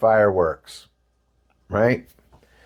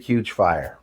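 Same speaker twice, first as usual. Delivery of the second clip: ordinary delivery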